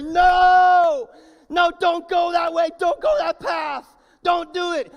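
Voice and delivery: high, full-force yell; urgent warning shout. Acted. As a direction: shout as if grabbing a friend's arm. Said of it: "grab their arm and say".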